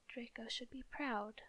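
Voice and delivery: plain reading voice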